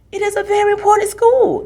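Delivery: high, loud, hammed-up indignation; mock offense. Big sister defending her school in hammed-up mock offense. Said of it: "Ilara said in a mock offense".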